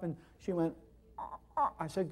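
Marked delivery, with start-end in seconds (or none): 1.2-1.7 sharp sniffing gasps. Retold or imitated. Patient tries breathing through nose.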